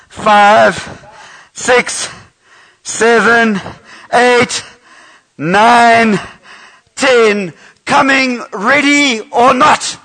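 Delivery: put-on counting out loud for the kids; loud counting call. Counting aloud in hide and seek.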